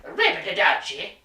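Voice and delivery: badly faked Italian accent; attempted Italian accent. Gamer joking about what Mario does, mock Italian accent. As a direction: put on a jokey Italian accent. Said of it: "I tried to do an Italian accent".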